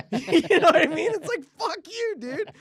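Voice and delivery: high, loud laughing curse; laughing, high "fuck you, dude"; laughing outburst. Real, not acted. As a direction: laugh it out while cursing the guy.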